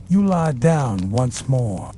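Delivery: plain reading voice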